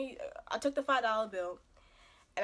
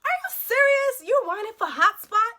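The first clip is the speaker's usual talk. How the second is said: loud, high-pitched disbelief; incredulous outburst; 11 semitones higher, 12 dB louder than usual. Acted. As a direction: call him out, loudly appalled. Acted exasperation at whining date.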